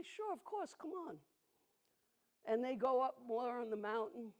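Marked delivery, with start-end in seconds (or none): 0.0-1.2 much higher, inviting voice; friendly welcome. Retold or imitated. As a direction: welcome the sheep along, pitched way up. Animals welcome the sheep.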